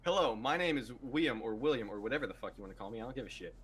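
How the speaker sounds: ordinary talk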